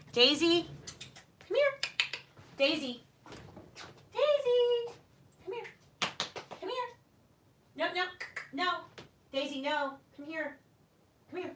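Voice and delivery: very high, sing-song dog call; high calls to the dog to come. Real, not acted. Calling the dog over.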